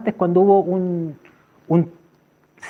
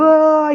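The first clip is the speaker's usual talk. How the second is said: high, sustained "ah" wail; long open-throated howl; imitated chanting cry; 9 semitones higher than usual. Teller imitating those drumming on, high wail. Imitates the others' cries with drums.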